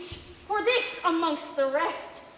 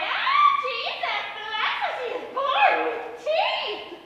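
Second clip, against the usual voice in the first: much higher, shrill, horrified mock women's cry; 9 semitones higher, 5 dB louder, slower than usual. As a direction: ape the wailing women, half laughing. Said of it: "and the women cried".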